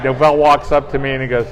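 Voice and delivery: ordinary delivery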